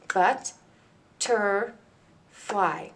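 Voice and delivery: "But, ter, fly" with a pause after each syllable; syllables split apart. Shown as a demonstration. Teacher breaking butterfly into syllables for students, slow and clear. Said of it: "broken down into three smaller syllables".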